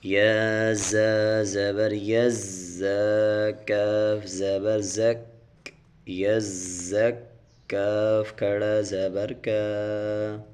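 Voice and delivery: slow, measured tajweed recitation; Quran recitation. Shown as a demonstration. Teacher spelling Quran syllables for students, slow chant. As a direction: chant each syllable slowly and clearly.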